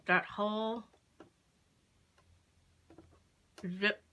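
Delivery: talking with pins in mouth; mouth full. Real, not acted. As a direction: mouth full of pins, words held back. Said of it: "Sorry, I've got pins in my mouth".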